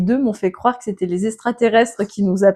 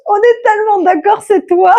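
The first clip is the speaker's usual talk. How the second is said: high, laughing, almost crying; 11 semitones higher, 5 dB louder than usual. Real, not acted. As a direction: squeal it through laughter. Laughing at the vote result.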